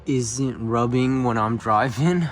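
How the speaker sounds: yelling over leaf blower. Real, not acted. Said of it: "sorry for the leaf blower".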